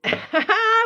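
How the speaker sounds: sudden high loud burst of laughter; a loud, high laugh breaking out; real laugh. Real, not acted. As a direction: crack up at your own question. Laughs at own pronunciation question.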